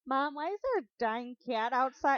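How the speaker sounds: high, slow, loud call to Mom; puzzled child. Pretend. Child asking Mom about awful noise, high puzzled call. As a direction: play a bewildered kid calling out. Voicing child hearing terrible singing.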